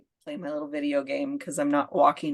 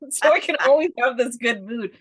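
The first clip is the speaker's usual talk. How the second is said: higher, laughing put-on greed for good moods; laughing mock wish; 5 semitones higher, 5 dB louder than usual. A host mocking her wish for endless good moods, laughing. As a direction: chuckle through the wish. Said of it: "wanting to dissect it".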